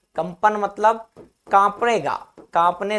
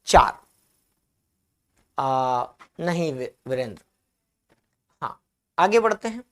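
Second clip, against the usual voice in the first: normal talking voice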